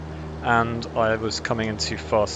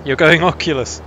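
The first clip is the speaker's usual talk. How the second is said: surprised; 4 semitones higher, 11 dB louder than usual. Real, not acted. Surprised at friend's news.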